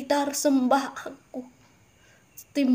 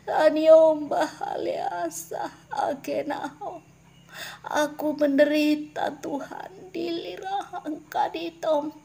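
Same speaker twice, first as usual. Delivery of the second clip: higher, anguished calls of Tuhan; lamenting cry of the regretful; crying out to God; 5 semitones higher than usual. Pretend. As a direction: plead as the suffering crowd. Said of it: "banyak orang akan berseru".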